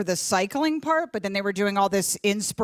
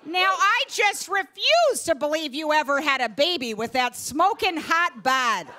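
a hint of a mom laying on the charm; hammed-up compliments to a friend's photo; mock flirty mom; 5 semitones higher than usual. Mom flirting as dad on Facebook.

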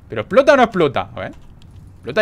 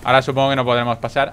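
ordinary talk

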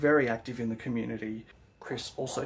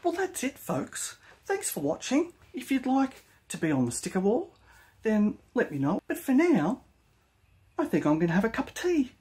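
much higher, loud-peaked bad impersonation; Shane; 10 semitones higher, 4 dB louder than usual. Host signing off to viewers, terrible impersonation of Shane. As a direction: swoop up high in a hammed-up send-up. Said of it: "a terrible impersonation".

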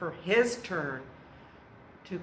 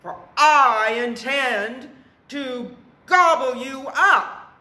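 loud, high troll shout; threatening troll; 8 semitones higher, 10 dB louder than usual. A narrator's reading. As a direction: threaten the goat at full volume.

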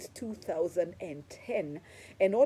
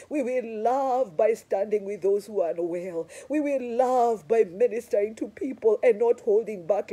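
building sermon; 4 semitones higher, 6 dB louder than usual. Acted. Preaching climax building.

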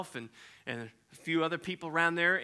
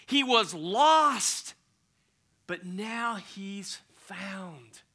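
slow dramatic fall; 3 semitones higher, 3 dB louder, much slower than usual. Acted. Father to servants, slow fading proclamation. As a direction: let it sink in, slowing down.

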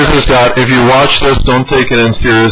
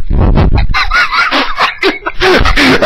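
a very high, screeching laugh; shrieking laugh. Real, not acted. Laughing after his speech on respect, a screeching high laugh.

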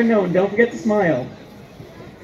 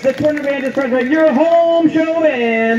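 stadium announcer; 5 semitones higher than usual. Real, not acted. Announcer presenting the home show band to the crowd, ringing announcement. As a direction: build up the big introduction, stretching the final name.

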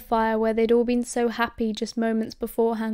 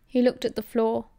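plain reading voice

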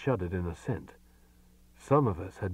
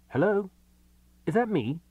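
higher, hesitant phone greeting; 10 semitones higher, 4 dB louder than usual. A narrator's reading. Man answers the phone, hesitant and higher. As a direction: ask it nervously, pitched up. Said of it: "after a moment's hesitation asked".

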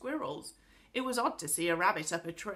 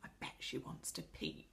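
very soft, low conspiratorial whisper; 7 semitones lower, 12 dB quieter than usual. A narrator's reading. Boy whispers to his companion about the girl, whispering. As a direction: whisper it slyly with a grin. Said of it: "whispered Joe to Beth with a grin".

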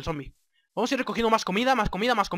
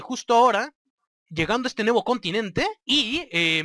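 ordinary talk

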